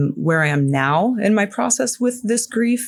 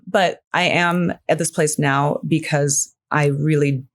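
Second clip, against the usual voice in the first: ordinary delivery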